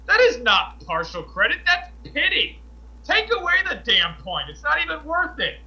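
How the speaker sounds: high-pitched, exasperated retort; worked-up, indignant comeback; indignant protest. Retold or imitated. Acting out protest over meager credit.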